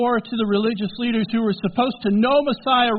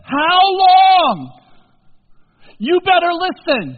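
much louder, higher furious cry; angry outburst; 10 semitones higher, 10 dB louder, slower than usual. Acted. Jesus out of patience with his disciples, furious cry. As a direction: explode in holy frustration. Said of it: "steps out of his quiet, gentle tone, and says".

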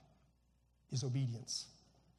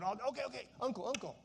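higher, hammed-up pleading to give in; mock surrender; 5 semitones higher, 4 dB quieter, much faster than usual. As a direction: pretend your arm is being twisted. Acts out giving in to arm-twisting.

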